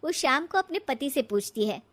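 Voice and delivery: ordinary talk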